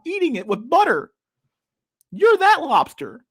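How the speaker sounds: hammed-up high parody rant; mocking impression. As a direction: whine it down at them like a smug lecturer. Lobster rant in mock voice.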